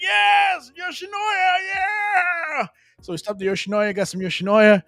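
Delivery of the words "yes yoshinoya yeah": high-pitched whoop of joy; excited shout. Retold or imitated. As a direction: yell it like you just found the perfect spot. Overjoyed at spotting Yoshinoya.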